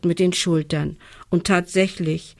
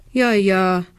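usual speaking voice

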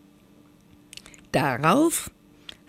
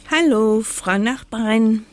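loud, higher croak of a greeting witch; 6 semitones higher, 3 dB louder than usual. Acted. Witch greeting her neighbour the sun, croaking loudly. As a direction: call out like a croaky old witch. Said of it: "krächzt die Winterhexe".

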